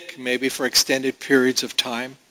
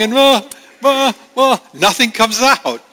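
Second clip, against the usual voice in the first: put-on high, choked stammer of a crying child; stammering toddler; 9 semitones higher, 3 dB louder than usual. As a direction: mimic a kid too upset to speak. Imitating toddler unable to speak.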